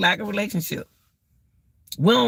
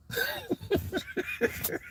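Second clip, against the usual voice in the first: high airy laugh; 11 dB quieter than usual. Real, not acted. Laughing at own bad mouth.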